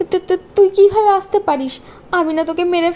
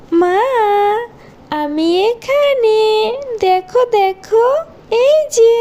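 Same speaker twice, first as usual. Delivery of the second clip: put-on ghost girl calling her stepmother; ghostly child; 3 semitones higher, much slower than usual. Ghost girl calls again.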